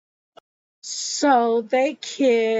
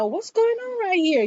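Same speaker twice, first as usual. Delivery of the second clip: puzzled question; 11 semitones higher than usual. Retold or imitated. Voicing Gesh, puzzled.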